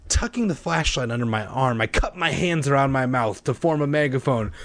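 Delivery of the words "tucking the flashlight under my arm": ordinary delivery, nothing marked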